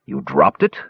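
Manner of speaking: a mild disbelieving groan, rising at the end; lightly voiced dwarf, can't believe it; groaning disbelief. A narrator's reading. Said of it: "he groaned in disbelief".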